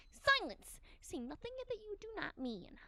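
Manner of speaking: sharp, high, imperious command; stern commanding detective. Acted. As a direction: snap at him like a stern superior. Shigure scolding Yama.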